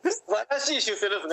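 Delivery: laughing. Real, not acted. Laughing at partner's joke.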